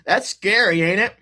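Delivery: bright playful "ain't it"; teasing excitement. Real, not acted. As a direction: tease the viewer, amused. Teasing aside to viewer.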